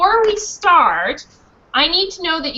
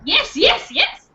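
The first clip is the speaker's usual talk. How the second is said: excited; 8 semitones higher, slower than usual. Acted. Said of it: "repeat after me".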